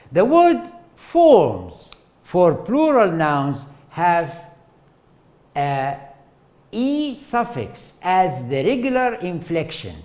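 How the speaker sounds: lightly formal lecture read; textbook reading voice. Acted. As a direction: read it off the slide like a formal definition. Reads definition from slide.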